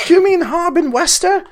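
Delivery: put-on high old lady voice repeating the question; old woman. Innkeeper's wife repeats from the back, high old-woman voice. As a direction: pitch way up, sweet elderly wife asking again. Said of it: "I don't know why she's so old".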